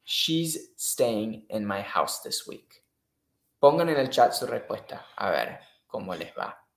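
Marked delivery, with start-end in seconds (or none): 0.0-2.8 slow read option. Shown as a demonstration. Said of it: "O C".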